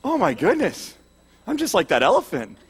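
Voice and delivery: lightly choked-up sympathy, put on; mock emotional. Viewer pitying the orphaned elephant on screen, mock emotional. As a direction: gush with pity, half-hearted tearfulness. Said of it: "getting all emotional".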